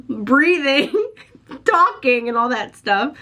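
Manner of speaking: laughing through the words, a bit breathless; laughing, catching breath. Real, not acted. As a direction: giggle while gasping for air. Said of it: "trying to catch my breath".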